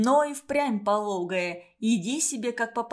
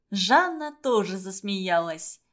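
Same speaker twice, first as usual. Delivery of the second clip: ordinary delivery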